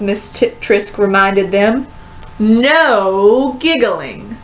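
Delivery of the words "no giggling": slow, stern reprimand; stern teacher. A narrator's reading. Teacher silences giggling class, slow and stern. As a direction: draw it out, strict teacher.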